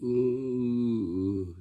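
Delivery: demonstrated block on one drawn-out sound; stutter demo. Shown as a demonstration. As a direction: get stuck on a long "ooh".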